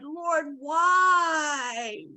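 drawn-out anguished wail, not full volume; mock scream to God. Host acting out a believer's prayer, imitated anguished scream. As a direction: wail it as an acted plea. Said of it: "you're screaming to God".

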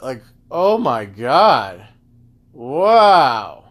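normal talking voice

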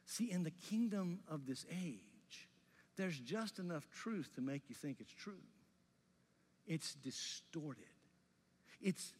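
ordinary talk

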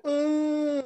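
Master P grunt. Retold or imitated. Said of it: "Make him go".

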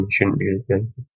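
usual speaking voice

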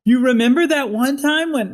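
high, projected blurt; loud, not angry. Shown as a demonstration. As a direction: say it loudly without any anger. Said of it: "I want him just to say it loudly".

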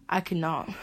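ordinary talk, nothing marked

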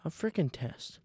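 whisper. Real, not acted. Annoyed aside.

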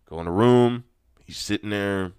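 ordinary talk

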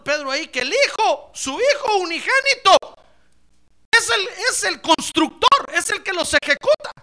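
booming, laughing sermon; fervent preaching. Acted. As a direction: preach it joyfully to the church. Preaching with rising force.

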